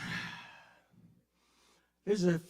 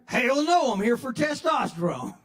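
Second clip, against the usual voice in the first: higher and louder, a gruff indignant boomer; indignant old guy; 9 semitones higher, 7 dB louder than usual. Acted. Old patient snapping at the clinic receptionist, indignant and loud. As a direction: bark it back like an offended old man.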